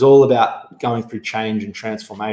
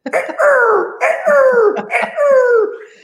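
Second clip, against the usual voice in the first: loud high gecko call imitation; gecko chirp; 5 dB louder than usual. Shown as a demonstration. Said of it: "I'll do it for you 'cause I'm sure you'll love it".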